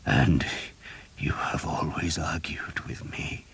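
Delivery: a weak, breathy whisper of a dying man; hushed dying priest. A narrator's reading. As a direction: whisper it, barely any strength left.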